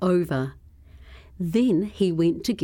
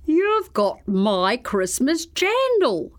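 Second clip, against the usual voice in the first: cried out; 5 semitones higher, slower than usual. A narrator's reading. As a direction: cry out in discovery. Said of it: "cried the crocodile".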